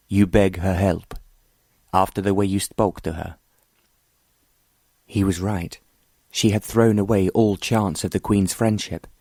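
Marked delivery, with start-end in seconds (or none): none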